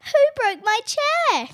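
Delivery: slow, high, loud eerie cry; mama bear crying out over her chair; spine-chilling cry. A narrator's reading. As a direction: wail it creepily like mama bear. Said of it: "cried a softer but still spine-chilling voice".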